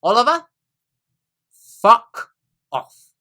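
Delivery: imitating her loud, exasperated snap; high, forceful curse, quoted back at full volume; retold outburst. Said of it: "And she said".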